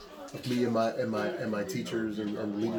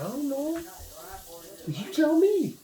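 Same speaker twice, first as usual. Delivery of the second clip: much higher, half-laughing "I don't know, you tell me"; exasperated retort; 3 dB louder, slower than usual. Retold or imitated. Preacher mimicking his answer to seekers, high mock exasperation. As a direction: toss the question back in disbelief, pitched way up. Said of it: "I'm saying, like".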